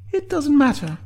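high, loud interruption; interrupting rebuke. A narrator's reading. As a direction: break in high and firmly. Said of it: "interrupted Sinead, reasserting her position".

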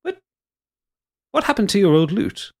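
high, sharp suspicious question; suspicious brother. Acted. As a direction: pounce on the missing loot. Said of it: "He goes".

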